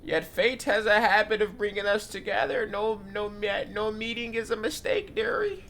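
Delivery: put-on high, slow old granny; grandma voice. Granny reassuring the host, high and slow. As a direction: coo like a cosy old granny. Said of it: "My grandma voice kinda mid".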